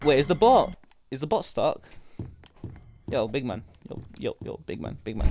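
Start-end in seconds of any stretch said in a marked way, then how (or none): 3.1-5.3 whispering. Real, not acted. Whispering to hide from bot.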